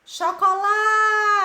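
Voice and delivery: "chocolate" chanted high and loud, LA held long; slow, high call of "chocolate" with a stretched LA; stress on penultimate syllable. Shown as a demonstration. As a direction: sing out the "la" of "chocolate". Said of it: "a sílaba mais forte é a penúltima".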